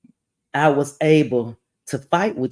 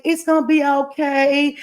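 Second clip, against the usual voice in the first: soothing imitation; 10 semitones higher than usual. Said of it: "she was like".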